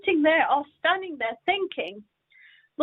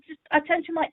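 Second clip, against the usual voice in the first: normal talking voice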